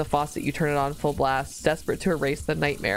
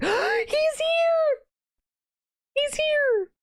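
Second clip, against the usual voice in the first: excited squeal; much slower than usual. Real, not acted. Streamer seeing her favourite character arrive, excited squeal. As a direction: gasp and squeal with delight.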